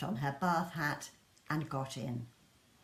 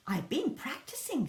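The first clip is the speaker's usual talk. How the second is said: put-on child whisper; 7 semitones higher than usual. Lester pleads.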